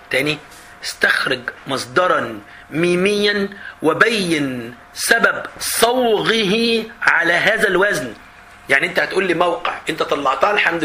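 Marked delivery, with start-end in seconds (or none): 0.8-8.1 very slow, measured dictation; formal classical Arabic, dictated slowly; exam question read-out. Acted. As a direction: stretch each word out formally.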